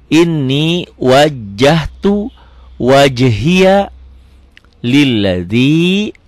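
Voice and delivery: "wajjahtu" slow, with a held double j; doubled jim. Shown as a demonstration. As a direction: recite slowly, holding the j in "wajjahtu". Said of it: "double jim".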